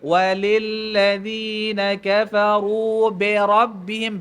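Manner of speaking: Walillazina kafaru bi rabbihim recited slowly, each letter full; slow recitation. Shown as a demonstration. Teacher recites the verse from the start slowly. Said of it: "dia hentam daripada awal tu".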